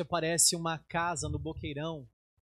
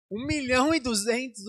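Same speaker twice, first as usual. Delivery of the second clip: louder and higher, stunned by the price; shocked exclaim; 8 semitones higher, 6 dB louder than usual. Retold or imitated. Pastor repeating the price he was told, stunned. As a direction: blurt the price out in disbelief.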